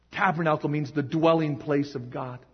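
ordinary talk

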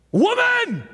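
very high, loud shouted "Woman!"; Jesus shouting at his mother, deliberately overdone; harsh shout. Acted. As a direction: bark it like an angry man. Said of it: "I bet he didn't say it like that".

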